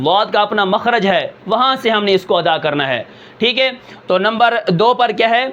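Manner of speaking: normal talking voice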